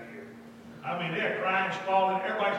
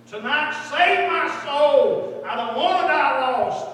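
loud, high, drawn-out cry for salvation; squalling plea; 8 semitones higher, 13 dB louder, slower than usual. Retold or imitated. Girl praying at altar, retold squalling plea. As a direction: cry out her prayer at full volume. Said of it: "And she squawked".

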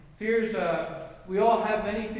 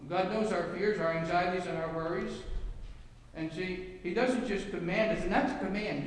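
ordinary talk

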